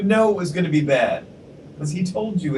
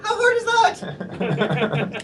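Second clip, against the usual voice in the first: high, slow, breaking into laughter; laughing; 13 semitones higher, much slower than usual. Real, not acted. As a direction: laugh it out in disbelief. Mocking the joke.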